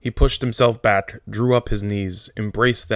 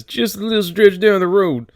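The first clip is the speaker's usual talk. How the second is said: much higher, louder sneering mimicry; mocking the bus driver; 11 semitones higher, 6 dB louder than usual. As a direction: ape the driver with scorn. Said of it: "Kicks us out and says".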